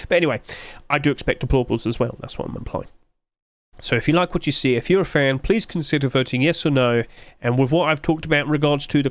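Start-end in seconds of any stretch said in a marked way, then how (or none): 2.2-2.9 whisper. Real, not acted. Aside after expecting deplorables.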